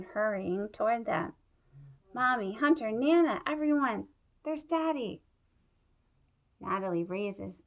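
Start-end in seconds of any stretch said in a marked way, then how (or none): 2.1-5.2 higher and louder, rising with joy; excited calling out. Acted. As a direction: call out to everyone in delight. Child sees returning father.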